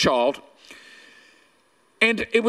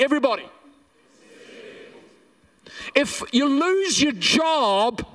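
preaching. Acted. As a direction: build each line then drop to the answer. Preaching call and response.

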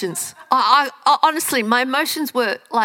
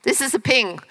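usual speaking voice

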